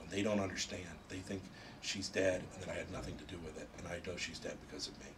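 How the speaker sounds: a hint of grieving Spider-Man; lightly voiced sorrowful confiding; quiet confession. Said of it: "tells the Human Torch".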